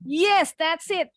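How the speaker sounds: normal talking voice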